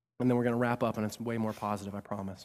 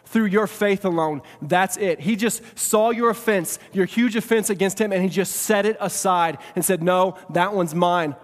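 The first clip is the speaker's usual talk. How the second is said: raised, emphatic sermon delivery; forceful preaching; 5 semitones higher, 7 dB louder, slower than usual. Real, not acted. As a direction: drive each phrase home to the congregation.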